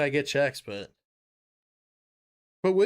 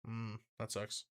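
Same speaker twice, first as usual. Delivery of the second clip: ordinary delivery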